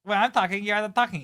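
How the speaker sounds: high, loud mock Spanish accent; exaggerated fake Spanish accent, shrieky and scolding. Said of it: "In the fake accent".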